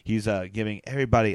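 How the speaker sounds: ordinary talk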